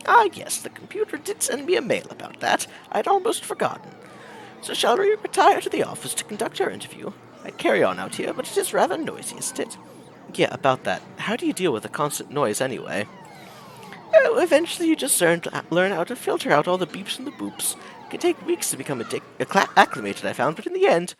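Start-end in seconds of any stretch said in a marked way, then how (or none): 0.1-9.8 put-on sweet elderly shopkeeper, higher voice; old lady voice. Owner arranges the interview. 14.1-21.1 put-on sweet elderly shopkeeper, higher voice; old lady voice. Owner on arcade noise.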